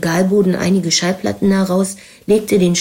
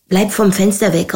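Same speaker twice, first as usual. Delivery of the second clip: ordinary delivery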